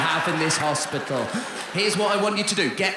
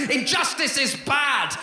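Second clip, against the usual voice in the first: shouting; 9 semitones higher, much slower than usual. Retold or imitated. Leftist stamping on the floor at injustice, shouting. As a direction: bellow it slowly like a slogan. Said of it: "and shouting".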